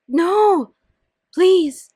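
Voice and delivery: shouted high, frantic no and please; desperate no. Acted. Andrea begging Louise not to tell Cassie, shouting. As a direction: beg in panic.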